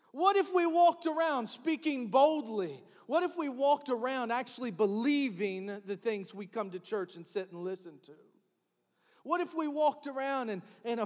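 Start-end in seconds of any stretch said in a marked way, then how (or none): none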